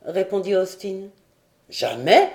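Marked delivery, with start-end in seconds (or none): 1.7-2.3 mildly stunned, low rising question; stupefied. A narrator's reading. Lord stunned by old sailor's answers, stupefied. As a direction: echo it in disbelief. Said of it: "stupéfait des réponses du vieux marin".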